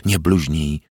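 ordinary delivery, nothing marked

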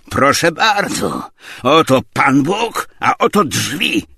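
loud, slow, high ushering out; emphatic dismissal. A narrator's reading. Zachar shows Tarantiew the door, slow emphatic dismissal. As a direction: announce each part deliberately.

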